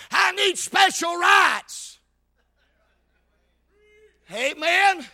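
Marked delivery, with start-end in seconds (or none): 0.1-2.0 slow, high, loud mock demand; preacher mockingly acting out a demand; hollering out. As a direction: holler it, dragging the words. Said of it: "he wasn't a hollering out".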